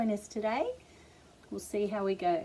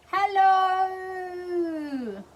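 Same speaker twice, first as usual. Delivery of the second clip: a big booming hello, higher and louder; 11 semitones higher, 8 dB louder than usual. Shown as a demonstration. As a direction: call hello out as loud as you can. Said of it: "as loudly as we can".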